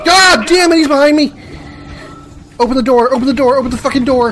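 high, loud panicked yelling; screaming for the door to open; being chased. Real, not acted. Monster behind him at door.

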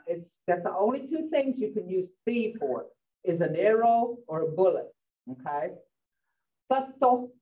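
normal talking voice

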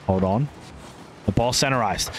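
ordinary talk, nothing marked

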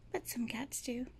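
soft whispered tag line. Real, not acted. Vlogger ending the clip to viewers, soft whisper. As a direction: drop to a whisper to finish.

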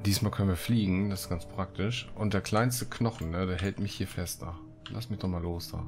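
usual speaking voice

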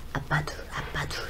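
breathy secret whisper of a spirit; grandma's whisper. Acted. Shaman passing on the grandmother spirit's words, whispered. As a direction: hush it like the ghost is talking through you. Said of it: "우리 할머니가 애 아빠가 두 명이 보인대".

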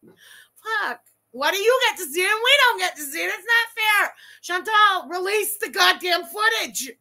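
loud, high, fast hammed-up meltdown; mock tantrum. Fan demanding the cut footage in a hammed-up tantrum. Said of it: "I'm gonna have a tantrum now, a meltdown".

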